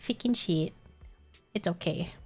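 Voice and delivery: normal talking voice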